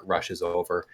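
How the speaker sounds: normal talking voice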